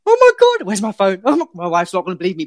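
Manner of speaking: excited. Retold or imitated. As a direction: retell the moment you lost it with joy. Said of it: "I'm like".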